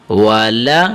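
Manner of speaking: usual speaking voice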